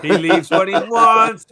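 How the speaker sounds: slow, loud playful tease; amused emphasis. Real, not acted. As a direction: rib him about his free hours, slowly. Teasing Bobby about leaving whenever.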